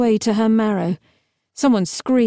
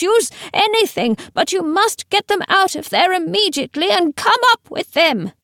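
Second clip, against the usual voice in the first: put-on high, panicky old man's plea; frightened calling; 9 semitones higher than usual. A narrator's reading. Teacher begs them to get the bodies out, frightened calling. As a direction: call down urgently in a high, terrified voice. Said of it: "he called back".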